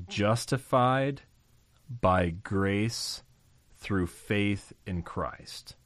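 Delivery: slow and deliberate, each word weighed; spoken slowly like a creed; slow, emphatic key phrase. Shown as a demonstration. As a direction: lay down the core phrase word by word. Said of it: "I'll say it this way".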